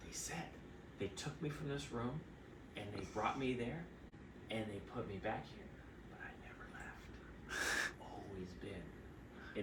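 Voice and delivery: soft unvoiced confiding whisper, slow; hushed whisper. Drugged patient whispering conspiracy to her doctor. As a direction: confide it like a paranoid secret. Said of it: "She goes".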